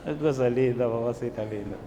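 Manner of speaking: lightly voiced frail granny; a hint of a shuffling old woman; little old lady. Pastor mimics a frail old granny shuffling along, lightly. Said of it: "caminaba como esas abuelitas".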